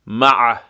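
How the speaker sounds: مع ending in a heavy ʕ squeezed from the mid throat; مع with a thick throaty ʕ; thick ain. Shown as a demonstration. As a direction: press the ع of مع hard in the throat. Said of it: "عین غلیظ".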